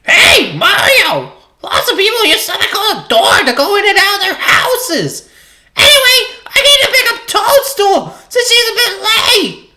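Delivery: high, loud squeaky Toad shout. Acted. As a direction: shout as a tiny mushroom man. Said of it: "I have to do a Toad voice".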